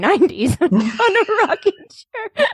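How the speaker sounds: laughing through the joke. Real, not acted. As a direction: giggle as you say it. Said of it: "laughing at own joke".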